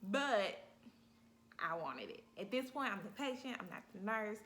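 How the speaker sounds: usual speaking voice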